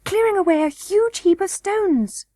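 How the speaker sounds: put-on high little-girl voice; young princess Irene, busy. A narrator's reading. Princess tells the boy about clearing stones, high-voiced. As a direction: answer brightly pitched as the princess.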